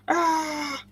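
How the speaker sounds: drawn-out high "ah" in a wacky voice; mock cry, held high; silly high cry. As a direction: let out a long, high, playful squeal. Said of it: "I have no idea why I'm doing that accent".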